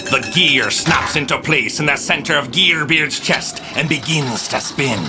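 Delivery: usual speaking voice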